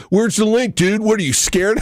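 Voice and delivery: goofy high bro taunt; mocking annoying chatter. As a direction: mimic the obnoxious viewer, sneering. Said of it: "that's your freaking introduction to me".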